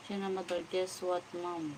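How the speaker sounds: ordinary talk, nothing marked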